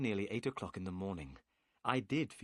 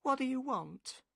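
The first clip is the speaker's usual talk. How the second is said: higher woman's voice, wary; suspicious woman; 14 semitones higher than usual. A narrator's reading. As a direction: ask as the wary wife. Wife answers the stranger.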